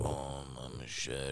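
put-on low, quiet drunken mumble; imitating barely able to talk. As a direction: act out a mate too wasted to form words. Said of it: "could barely even fucking talk".

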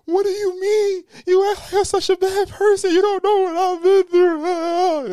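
high, loud, wailing mock cry; mock crying girlfriend. Girlfriend answering calm criticism, loud high mock crying. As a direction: wail it way up high like a hurt girlfriend. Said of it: "girlfriend crying in response".

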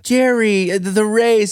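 high, hesitant voice of the timid assistant. Retold or imitated. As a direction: nervously let the boss down. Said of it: "And she's like".

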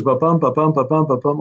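"Ba-bum" tapped out as da-DUM, weight on "bum"; iambic rhythm. Shown as a demonstration. Writer showing the interviewer his script's meter, rhythmic da-DUM beats. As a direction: beat out the iambic meter, "ba" light, "bum" strong. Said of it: "but the meter continues".

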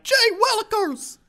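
put-on high excited country boy; squeaky yokel yelp. Kid answering pa.

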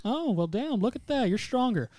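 high, lilting mild surprise; mock impressed. Villain retold as casually impressed.

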